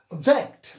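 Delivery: "Object" with a loud, punched "-ject"; teacher's model "Object", ob-JECT; stress on second syllable. Shown as a demonstration. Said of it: "accent is given on the second syllable".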